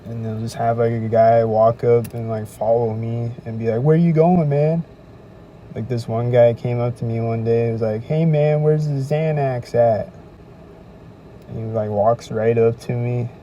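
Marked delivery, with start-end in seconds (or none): 3.8-4.8 higher and louder, pushy call-out; lightly voiced sketchy stranger; mock street guy. Stranger follows him asking where he's going, pushy. 8.0-10.1 louder, slow, leaning-in ask; lightly voiced shady stranger; mock sketchy guy. Shady guy in the alley asks him for Xanax, sketchy mock.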